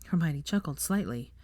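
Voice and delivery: usual speaking voice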